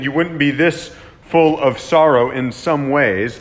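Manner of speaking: ordinary talk, nothing marked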